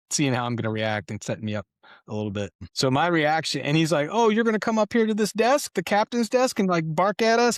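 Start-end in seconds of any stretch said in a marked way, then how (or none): 4.1-7.6 high, loud mocking jab at the new captain; teasing sergeant daring the new officer; mocking challenge. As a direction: needle him with a sarcastic, much higher voice.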